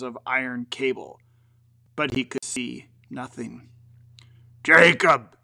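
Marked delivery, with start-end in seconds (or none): 4.6-5.4 imploring. Acted. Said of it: "he said imploringly".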